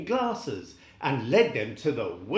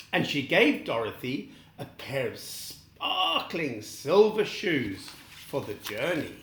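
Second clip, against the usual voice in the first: plain reading voice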